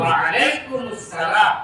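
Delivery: very slow, syllable by syllable; teacher spelling out the reply slowly; slow drill. Shown as a demonstration. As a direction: stretch every syllable for the class to copy.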